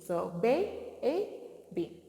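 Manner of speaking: put-on baby drawn out slowly, long ay in ba; slow sounded-out word. Shown as a demonstration. Teacher sounding out baby slowly for children. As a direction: stretch baby out for the class. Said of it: "we know that this is baby".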